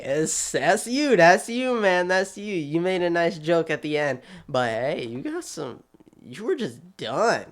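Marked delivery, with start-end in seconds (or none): none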